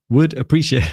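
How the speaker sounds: playfully shouting out capital letters; lively mock shout of a written message. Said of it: "I love his shouting".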